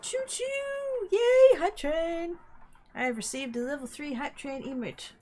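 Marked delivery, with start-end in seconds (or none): 0.0-2.4 high cheering, slow drawn yay; excited. Real, not acted. Celebrating a hype train.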